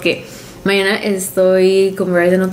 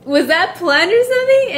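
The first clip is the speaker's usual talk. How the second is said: surprised; 11 semitones higher than usual. Retold or imitated. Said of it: "I was like".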